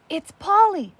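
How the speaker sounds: alarmed realisation. Acted. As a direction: spot him and cry out.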